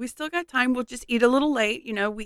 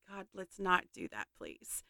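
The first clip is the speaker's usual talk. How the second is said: quiet plea; 3 semitones lower, 15 dB quieter than usual. Retold or imitated. Pleading with dog near horses.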